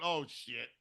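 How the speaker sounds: ordinary talk, nothing marked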